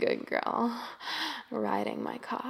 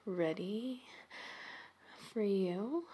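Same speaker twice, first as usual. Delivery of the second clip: ordinary delivery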